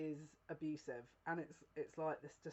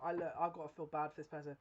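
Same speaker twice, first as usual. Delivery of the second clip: ordinary delivery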